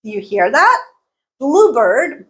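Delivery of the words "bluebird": "Bluebird" as BLUE-bird, bird weak; reduced second word. Shown as a demonstration. Teacher showing a compound word, bird reduced. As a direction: punch blue and tuck bird away in "Bluebird". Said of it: "bird is really reduced".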